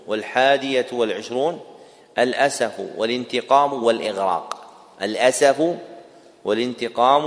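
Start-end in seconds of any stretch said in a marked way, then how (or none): none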